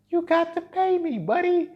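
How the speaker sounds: high, drawn-out teasing demand; comic high-pitched insistence, slowed down; playful demand. Acted. Playful demand to imagined client.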